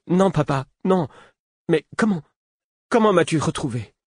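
put-on high child's voice, dismayed; overwhelmed. A narrator's reading. Runaway boy answers his father, overwhelmed and high-pitched. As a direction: answer father, crushed at being found. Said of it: "L'est avait un ton accablé".

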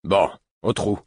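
icy tone. A narrator's reading. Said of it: "d'un ton glacial".

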